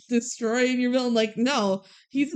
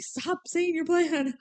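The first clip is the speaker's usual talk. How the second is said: mock exasperated scolding; 6 semitones higher, 3 dB quieter than usual. Mimics Shego scolding Drakken.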